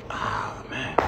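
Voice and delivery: breathless whispered "Ah, man"; whispered dismay. Retold or imitated. Comedian dreading his search, whispered dismay. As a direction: sigh it out in a whisper. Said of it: "I'm sitting there like".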